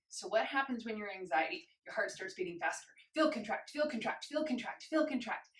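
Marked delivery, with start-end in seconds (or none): 3.1-5.5 quick, higher rhythmic chant of "feel contract"; anxious fast heartbeat. Shown as a demonstration. Instructor cueing an anxious heartbeat, quick higher chant. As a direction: rattle it off like a racing heart. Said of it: "imagine that you're in a state of anxiety".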